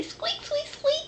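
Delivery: high squeaky mouse voice; mouse squeak. Pretend. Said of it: "They say".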